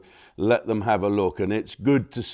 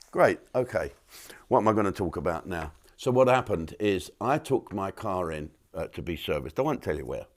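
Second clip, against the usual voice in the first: ordinary talk, nothing marked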